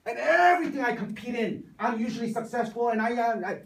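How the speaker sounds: high, loud boasting student; insistent student. Retold or imitated. Student answers the professor's doubt, insistent and high. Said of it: "And he says".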